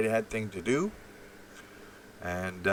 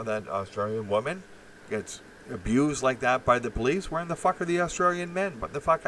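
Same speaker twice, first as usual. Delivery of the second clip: normal talking voice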